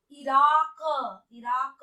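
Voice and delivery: इराक ending in throaty q; deliberate इराक with q from the throat; nukta pronunciation. Shown as a demonstration. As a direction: say इराक as iraaq, drawn out. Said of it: "क में हमें नुक्ते का उच्चारण".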